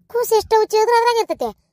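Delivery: high, loud disbelieving question; incredulous echo. Acted. Hearing about diapers for the first time, astonished. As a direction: throw the claim back, pitch shooting up.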